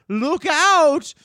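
high, very loud cry; shouted warning. Acted. Eagle in the air to the gnome, shouted warning. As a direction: bellow the warning from far above.

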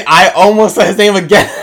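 loud, high, laughing through the words. Real, not acted. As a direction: laugh while catching your own slip. Laughs at own slip.

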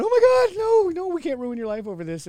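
high frantic mom voice; a mother panicking; panicked mother. Retold or imitated. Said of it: "she's burying all the bodies going".

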